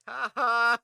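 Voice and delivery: loud, high mocking "ha ha"; mock laugh. Friend laughing at the player's ghost scare, loud mock laugh. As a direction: imitate a friend's gloating laugh, loudly. Imitates Tim's gloating laugh.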